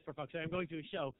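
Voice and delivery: ordinary talk, nothing marked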